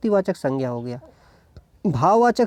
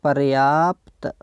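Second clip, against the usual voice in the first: पर्याप्त drawn out as par-yaa-pt; slow, stretched पर्याप्त; sounded out slowly; 3 semitones lower, much slower than usual. Shown as a demonstration. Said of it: "यानी ऐसे लिखेंगे".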